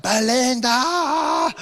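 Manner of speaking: high, very drawn-out wail; hammed-up cry for the wife; panicked call. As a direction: stretch the name into a mock-desperate call. Retold call after scorpion bite.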